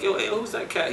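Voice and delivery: high, eager; excited question. Retold or imitated. Asking who the rapper in the live room is, high and eager. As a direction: ask it, thrilled.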